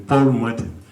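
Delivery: ordinary talk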